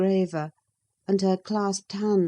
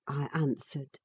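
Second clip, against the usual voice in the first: plain reading voice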